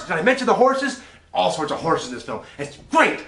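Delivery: hammed-up hyper enthusiasm; overexcited gushing. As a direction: shout it like a kid after the movie. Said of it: "Well, now that you've asked".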